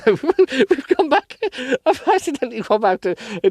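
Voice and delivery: high laughing voice. Real, not acted. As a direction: giggle through the correction. Laughing at her mistake.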